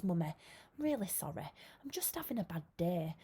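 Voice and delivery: put-on four-year-old son apologising to his mum, hushed; child's small apology. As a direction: say sorry like a sheepish little boy. Little boy apologising to mum.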